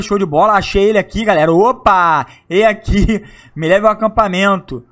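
ordinary delivery

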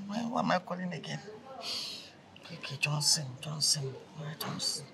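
soft unvoiced whisper to himself; whispered muttering. Caller whispering to himself while the phone rings, hushed. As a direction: whisper the name under your breath.

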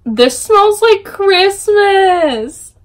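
high, drawn-out delight; delighted exclamation. Real, not acted. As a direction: draw it out slowly and high, thrilled. Thrilled by candle scent.

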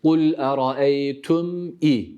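Kul eraeytum i very slow, each sound held; drawn-out Kul eraeytum i; slow recitation. Shown as a demonstration. Tutor models the reading slowly. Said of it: "Bu şekilde okuyacağız".

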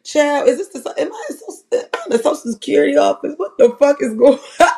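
high, fast, outraged sass; mock outrage. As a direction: pitch it up in disbelief, rattle it off. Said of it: "It was like".

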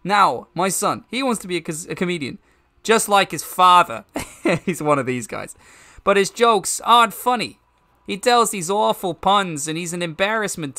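resort comedian. Acted. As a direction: punch it out like a hammy comedian.

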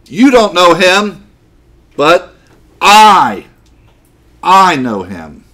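"I" set apart by pauses, high and loud; stress on I. Shown as a demonstration. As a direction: punch "you" and hit "I" harder still. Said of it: "That's the emphasis".

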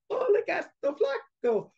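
put-on very high, squeaky little-girl taunt; teasing little girl. Girls sticking arms out to tease the cat, taunting. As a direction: tease in a tiny girlish voice. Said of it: "and the girls going".

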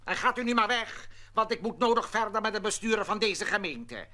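usual speaking voice

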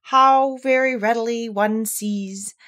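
slow, measured verse with lifted line openings; ponderous storybook poetry reading; poem recitation. A narrator's reading. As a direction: recite it deliberately, drawing out the rhymes.

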